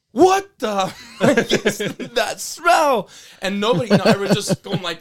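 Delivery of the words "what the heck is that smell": drill sergeant yell. Retold or imitated. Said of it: "He's all".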